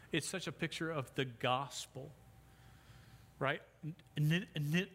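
ordinary delivery

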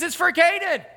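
high-pitched retold comeback; imitated insistent wife. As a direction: hammer it home as the exasperated wife.

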